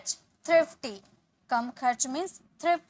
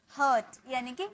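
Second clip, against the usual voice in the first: ordinary delivery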